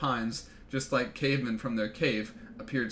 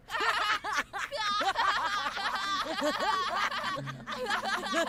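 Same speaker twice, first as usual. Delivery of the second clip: real long high-pitched cackle; real drawn-out high laugh; high shrieking laugh. Real, not acted. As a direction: burst into long shrill laughter. Streamer laughing at dog kicked.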